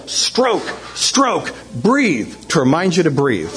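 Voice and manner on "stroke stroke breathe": high-pitched called-out swim cues; mock coaching yell. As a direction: call each cue out separately, without full volume. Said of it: "I'm gonna yell".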